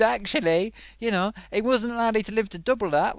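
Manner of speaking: normal talking voice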